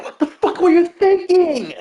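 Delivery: high-pitched furious tirade at the careless cleric; flipping out. Retold or imitated. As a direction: squeal it out in frustrated disbelief. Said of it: "I was flipping out".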